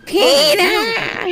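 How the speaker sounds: Lois impression. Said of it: "Hey, Lois".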